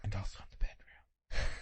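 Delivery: whispered, breathy aside. Acted. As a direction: whisper "And also in the bedroom." like a naughty secret. Sly aside about bedroom lines.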